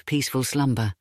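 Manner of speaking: ordinary delivery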